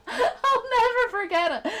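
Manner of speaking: giggling through the line; laughing. Real, not acted. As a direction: laugh out the words. Laughing while remembering.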